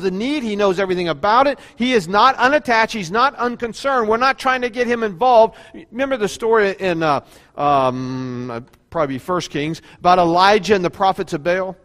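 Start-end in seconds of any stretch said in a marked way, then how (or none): none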